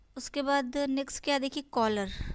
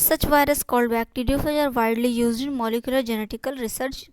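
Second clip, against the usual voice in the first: slow textbook reading; 8 dB louder, much slower than usual. Shown as a demonstration. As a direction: read the sentence out slowly, word by word. Reads textbook line aloud.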